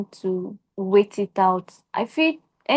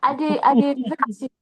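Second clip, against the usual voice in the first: Pidgin anger; 4 semitones higher than usual. Retold or imitated. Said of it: "acted Pidgin anger".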